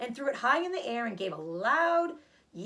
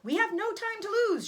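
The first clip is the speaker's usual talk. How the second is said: much higher and louder shout; 12 semitones higher, 5 dB louder than usual. Acted. Zuckerman shouts to hurry as the award is announced. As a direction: bark the urgency out. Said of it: "shouted Mister Zuckerman".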